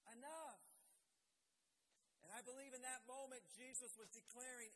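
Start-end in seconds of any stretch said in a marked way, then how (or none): 0.0-0.6 loud, higher cry of enough. Acted. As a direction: stop them all with one loud word. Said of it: "Jesus said".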